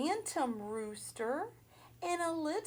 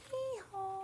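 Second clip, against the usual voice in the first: high mock hee-haw, lightly done; donkey bray; 7 semitones higher, 6 dB quieter than usual. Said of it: "the donkey gave one last bray".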